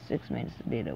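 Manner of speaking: muttered disbelief. Real, not acted. Repeating the complaint about the player to himself, a soft disbelieving mutter. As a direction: mutter it under your breath, dropping the pitch.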